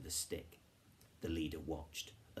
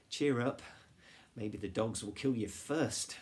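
higher, louder teasing cheer; joking; 4 semitones higher, 7 dB louder than usual. A narrator's reading. Girl cheering up her worried friend, joking. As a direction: tease it brightly, pitched up. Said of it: "joked Flavia".

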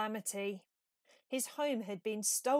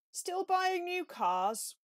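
higher, slow weary question; wry Badger; 10 semitones higher, 5 dB louder, slower than usual. A narrator's reading. Badger asks over cocoa in a higher, slow weary voice. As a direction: ask with a knowing sigh.

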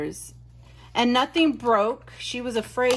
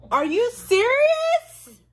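gasp, then a slow, much higher and louder question; real disbelief at the gift; shocked gasp; 7 dB louder, slower than usual. Real, not acted. Opening a Jeffree Star lipstick, stunned high gasp.

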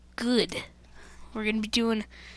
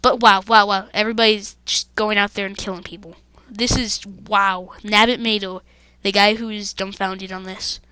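normal talking voice

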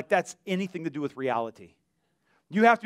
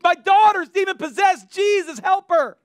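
full high shout of desperate pleading; imitated crying out; 13 semitones higher, 10 dB louder than usual. Mother crying to Jesus for her possessed daughter, imitated crying out. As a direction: shout the plea far higher, stretching the end. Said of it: "Here's a woman crying out".

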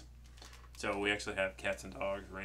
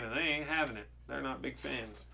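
plain reading voice

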